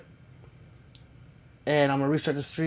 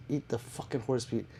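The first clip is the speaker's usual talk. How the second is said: normal talking voice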